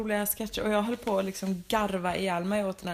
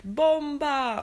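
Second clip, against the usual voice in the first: mock shout; 5 semitones higher, 4 dB louder than usual. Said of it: "de skrek liksom i låten".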